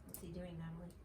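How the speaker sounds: soft aside to the listener; quiet aside. Real, not acted. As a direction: drop out of the story softly. Aside to a child.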